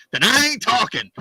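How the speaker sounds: high, loud, cocky refusal; smug John Candy. Retold or imitated. John Candy refusing the reporters, loud and cocky. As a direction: shut the press down with a grin. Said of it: "John Candy goes".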